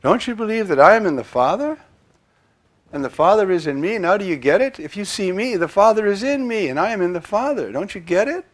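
loud, urgent voicing of Jesus with pressing questions; preaching at full force. Priest voices Jesus urging his disciples, loud and pressing. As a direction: plead with them, full voice.

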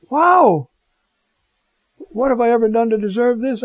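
stunned and thrilled; overwhelmed at the honour; amazed. Pretend. Amazed at the Pope's visit.

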